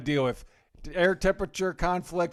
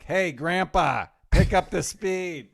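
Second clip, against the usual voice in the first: louder, slow teasing jab; mock taunt; 4 semitones higher, 6 dB louder, slower than usual. Wife texting during the caravan, mock taunting. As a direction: needle him like a slow old man. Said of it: "she would be texting me shit like".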